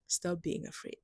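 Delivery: soft, hushed self-talk; quietly talking herself out of fear; calming herself down. Retold or imitated. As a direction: soothe yourself under your breath. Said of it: "I calm myself down and tell myself".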